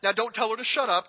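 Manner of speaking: normal talking voice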